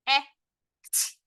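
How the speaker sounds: "Ect" split, vowel then final sounds; sounded out. Shown as a demonstration. Teacher tapping the syllable, sounded out. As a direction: separate the sounds of "Ect" with a gap. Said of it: "Next syllable".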